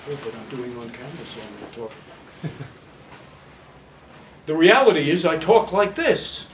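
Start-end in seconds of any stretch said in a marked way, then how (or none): 0.1-1.9 lightly mocking, much softer, lower and fast; a hint of a timid actor shrinking for the camera; mock tiny voice. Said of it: "would I be talking like this".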